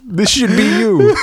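put-on a poor kid complaining about his gift, shouted high; mock kid wail. Mock kid, used condom gift.

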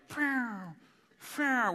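high, falling "phew" of a flying dart; imitated dart shots. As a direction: mimic darts whizzing off wildly. Imitates darts fired off target.

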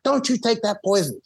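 put-on high warning voice of an old chief; old chief's warning. Old chief warning the visitor off the vaccine, high stern voice. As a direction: warn him off like a stern elder. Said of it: "So he's like".

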